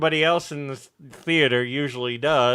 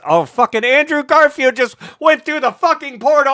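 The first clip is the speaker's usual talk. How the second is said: high, loud amazed outburst; much higher astonished exclamation; imitating stunned fan; 13 semitones higher, 6 dB louder than usual. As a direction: play a fan losing it in the theater. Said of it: "you know, surprise".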